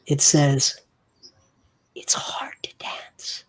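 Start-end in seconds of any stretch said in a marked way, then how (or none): 2.0-3.4 hushed, voiceless whisper; low whisper. Acted. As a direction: whisper it very low, like a secret password. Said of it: "you hear a whisper, very low".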